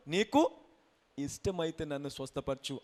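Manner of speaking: acted soft, low request; humble plea. Leper asking to be healed if willing, meek. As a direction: ask meekly, falling away in volume.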